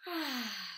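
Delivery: long sighed "ah", letting go; relaxed sigh. Acted. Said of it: "Like a relaxing sound".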